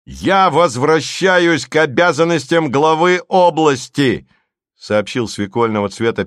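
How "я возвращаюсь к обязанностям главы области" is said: громко, медленно, высоко, как официальное объявление; объяви это в камеру торжественно; торжественное заявление. A narrator's reading. (зычно и размеренно провозгласить)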